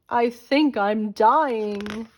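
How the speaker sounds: slow, groaning moan through a sore jaw; a hurting George moaning to himself. A narrator's reading. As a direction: drag it out like he's in pain. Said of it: "he held his jaw and groaned".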